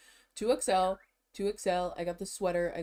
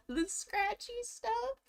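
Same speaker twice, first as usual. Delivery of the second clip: much higher, slow squeaky voice; squeaky mock voice; slower than usual. Vlogger describing scratchy lettering in a silly high voice.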